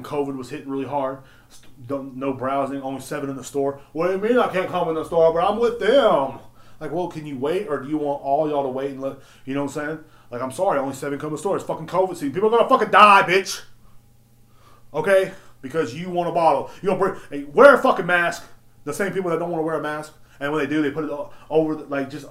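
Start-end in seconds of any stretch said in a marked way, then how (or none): 3.9-6.4 louder, higher whiny customer; hammed-up whiny customer; mock customer complaint. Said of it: "customer complaint". 12.3-15.3 loud, higher rant; real exasperated outburst; angry shout. Real, not acted. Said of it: "covid rant". 17.4-18.4 loud, sharp demand; real exasperated yell; angry shout. Real, not acted. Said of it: "covid rant".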